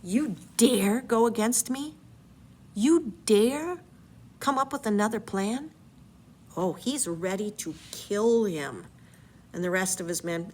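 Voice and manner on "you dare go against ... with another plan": a lightly acted indignant challenge; mild mock outrage; mock angry Odysseus. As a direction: play the offended captain, half-hearted. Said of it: "He is ticked right off".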